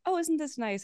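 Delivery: high, genteel mom voice; a high, prim ladylike voice. Retold or imitated.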